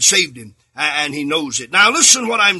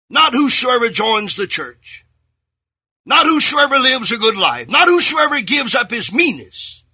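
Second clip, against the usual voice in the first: each line louder and higher, building to a shout; building sermon cry; 6 semitones higher, 4 dB louder than usual. Acted. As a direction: drive the point home, climbing each time. Building repeated preaching lines.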